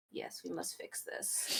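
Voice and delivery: conspiratorial whisper. Acted. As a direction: whisper it like a secret plan. NPC squishing the cat's belly.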